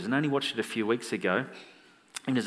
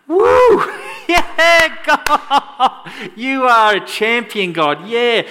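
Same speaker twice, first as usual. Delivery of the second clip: whooping, much higher and louder, laughing; mock cheering; 10 semitones higher, 12 dB louder, much slower than usual. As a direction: cheer God on like a stadium fan. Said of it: "I don't see many of you going".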